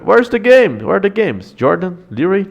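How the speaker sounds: high-pitched confused question; suddenly baffled, voice jumping up; surprised. Real, not acted. Can't find the games on stream.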